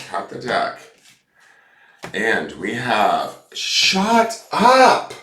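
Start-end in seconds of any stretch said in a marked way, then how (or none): 3.5-5.1 shrieked disbelief, much higher and louder; real shocked yelp of surprise; stunned outburst. Real, not acted. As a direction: blurt it out, floored by the card. Card reveal shocks her.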